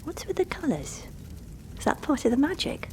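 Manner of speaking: put-on high, curious boy's question; surprised. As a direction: ask it wide-eyed and curious. Boy curious about coloured flames.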